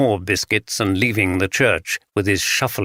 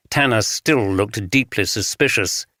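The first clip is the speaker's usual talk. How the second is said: usual speaking voice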